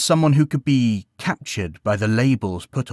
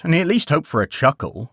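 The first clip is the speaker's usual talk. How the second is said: ordinary delivery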